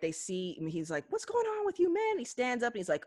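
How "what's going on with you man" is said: squeaky retold freak-out; alarmed movie re-enactment. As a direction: act out an alarmed kid brother. Acting out movie brother's alarm.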